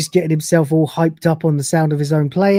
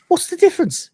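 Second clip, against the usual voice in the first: protesting retort; 13 semitones higher than usual. Acted. Lister defending his terrible playing.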